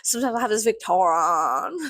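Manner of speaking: hammed-up, unplaceable accent on Victorian; mock accent. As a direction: play up the silly accent, drawing out Victorian as "vic-taw-rawn". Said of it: "What the fuck was that accent?".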